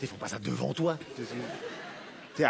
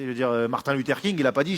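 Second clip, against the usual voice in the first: ordinary talk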